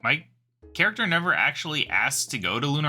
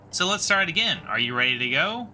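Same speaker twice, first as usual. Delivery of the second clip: ordinary delivery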